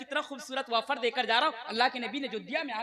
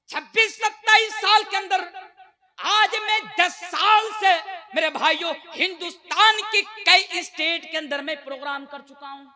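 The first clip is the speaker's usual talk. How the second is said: fervent sermon voice, rising in bursts; preaching at full force; 2 semitones higher, 10 dB louder than usual. Acted. Preacher rousing the congregation about faith, fervent preaching. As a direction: preach it to the crowd with conviction.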